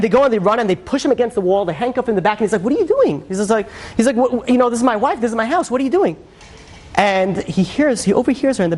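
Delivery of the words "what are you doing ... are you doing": raised, higher, bewildered "what are you doing"; a man stunned as police cuff him in his own house; shocked protest. Retold or imitated. Husband confronting cops at arrest.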